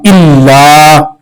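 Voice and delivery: इल्ला with the doubled l held and the final a drawn out one beat; lengthened alif. Shown as a demonstration. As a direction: stretch the ला in इल्ला. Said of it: "एक अलिफ की मिकदार लंबा करेंगे".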